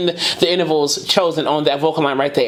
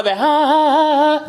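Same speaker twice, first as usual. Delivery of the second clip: breathy; 8 semitones higher, 3 dB louder than usual. Shown as a demonstration. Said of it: "breathy vocal emphasis".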